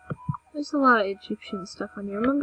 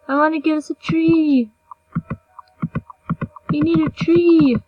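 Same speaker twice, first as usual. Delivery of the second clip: louder, higher, insistent demand for a tree; excited demand; 5 semitones higher, 8 dB louder than usual. Real, not acted. Gamer insisting on a tree for her house, loud and eager. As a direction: call for that tree like it's urgent.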